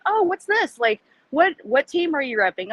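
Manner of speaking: high, bright curious stranger; curious passerby. Retold or imitated. Passerby asking about his football shirt, lightly voiced curiosity. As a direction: perk up like a stranger spotting the shirt. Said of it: "people are gonna be like".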